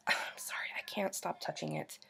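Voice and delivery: real whispered apology; whispered aside. Real, not acted. Apologizing to viewers mid-story, whispered aside. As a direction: drop into an unvoiced, very soft aside.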